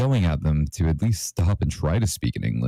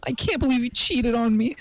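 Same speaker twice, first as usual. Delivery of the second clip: sobbing. A narrator's reading. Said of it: "Sonic sobbed and ran away".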